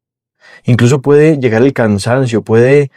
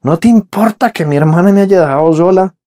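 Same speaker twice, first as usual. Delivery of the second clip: complaining to Jesus; 6 semitones higher than usual. Retold or imitated. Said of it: "le reclama a Jesús".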